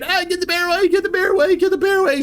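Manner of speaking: high pleading shouts; crowd screaming. Retold or imitated. Onlookers screaming at the bear to go away, high panicked calls. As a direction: call out in panic to drive the bear off. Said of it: "they're screaming".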